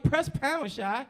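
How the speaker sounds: high, loud impatient command; furious. Retold or imitated. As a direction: snap it, losing patience. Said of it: "I'm getting furious".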